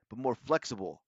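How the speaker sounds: usual speaking voice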